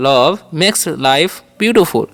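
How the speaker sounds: "love makes life beautiful" repeated slowly, falling at the end. Shown as a demonstration. Teacher repeating the English sentence slowly. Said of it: "ऐसे बोल सकते हैं".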